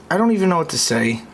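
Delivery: usual speaking voice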